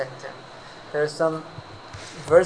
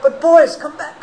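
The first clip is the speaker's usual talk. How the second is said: higher call after them; 10 semitones higher, 7 dB louder than usual. Acted. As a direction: call them back as they run. Wife calling after boys.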